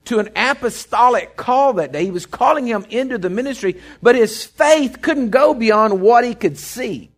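full-force preaching. Acted. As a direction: hammer home the point about faith.

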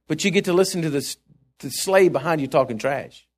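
normal talking voice

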